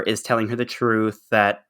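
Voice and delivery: ordinary talk, nothing marked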